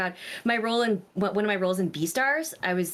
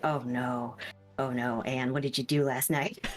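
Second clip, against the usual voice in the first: lower, softer dismay at her own voice; low tired morning voice; 8 semitones lower, 4 dB quieter than usual. Retold or imitated. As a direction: scold yourself in a croaky low voice. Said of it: "and I was like".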